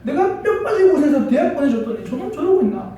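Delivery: loud, high-pitched mock reproach; resentful complaint. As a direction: act out a mother's grievance. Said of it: "원망이 많았거든요".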